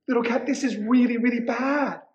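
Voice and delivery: high, quick little witch voice. A narrator's reading. Witch tells cat things are bad, high and quick. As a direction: rush it out high, as a worried small witch. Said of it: "the little witch said".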